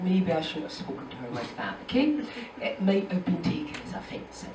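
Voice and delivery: ordinary talk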